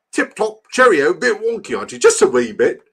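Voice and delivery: high, loud cartoon-English chirp; hammed-up English accent. Streamer rating his own accent, hammed-up English accent. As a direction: trot out British phrases in a mock English accent. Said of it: "How's my English accent?".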